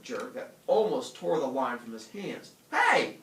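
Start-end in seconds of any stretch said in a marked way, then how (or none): 2.7-3.2 high, loud startled yell. Acted. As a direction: cry out in surprise. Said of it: "yelled Calvin".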